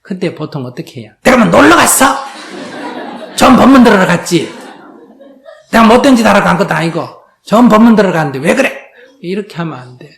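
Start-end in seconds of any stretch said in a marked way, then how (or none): 1.2-8.7 loud, higher, fast indignant retort with a laugh; defiant talking back. Acted. Wife snapping back at her husband about the temple, loud and indignant. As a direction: fire back, offended and self-righteous.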